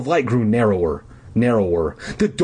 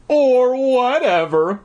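spooky; 12 semitones higher, 4 dB louder, slower than usual. Acted. Ominous emphasis in narration.

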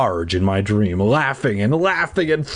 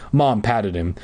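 plain reading voice

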